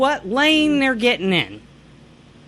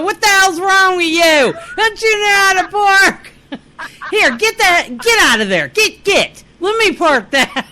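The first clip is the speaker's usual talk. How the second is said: high, loud parking-lot tirade; mock angry yelling; 8 semitones higher, 6 dB louder than usual. Friend imagining a parking confrontation with a bad driver, mock furious. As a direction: yell it like an irate driver, hammed up.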